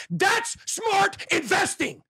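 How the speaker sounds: high, slow declaration of triumph; emphatic shout. Real, not acted. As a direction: hammer the point home, slowly. Ranting about selling at top.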